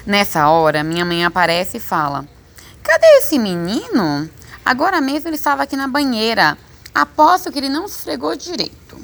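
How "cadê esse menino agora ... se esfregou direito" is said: put-on higher-pitched mother looking for her child; fussing mother. A narrator's reading. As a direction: complain like a mother hunting for her boy. (voz de mãe reclamando, mais aguda)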